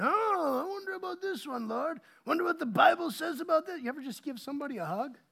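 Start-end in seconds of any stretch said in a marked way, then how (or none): 0.0-3.8 mock pondering. Someone talking theology instead of giving a hug, mock pondering. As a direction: ponder it aloud with exaggerated wonder.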